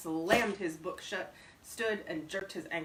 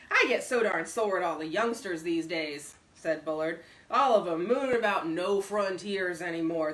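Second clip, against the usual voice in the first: a hint of a loud, boastful old man; lightly voiced old codger, louder and higher; gruff old braggart; 3 semitones higher, 8 dB louder than usual. A narrator's reading.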